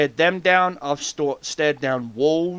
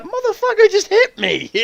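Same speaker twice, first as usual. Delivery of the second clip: much higher indignant snake voice; voicing the snake; 5 dB louder than usual. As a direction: squeal it as the offended snake. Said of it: "the rattlesnake's like".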